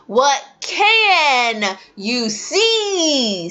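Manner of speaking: teacher asking slowly; slow drill question. Shown as a demonstration. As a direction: draw it out for beginners. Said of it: "see a butterfly".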